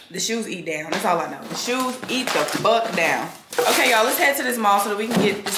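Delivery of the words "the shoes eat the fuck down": slow emphatic brag. Acted.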